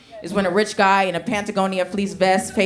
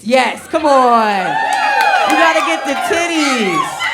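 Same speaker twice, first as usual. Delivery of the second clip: hyped shout; 4 semitones higher, 5 dB louder, slower than usual. Real, not acted. Hyping crowd.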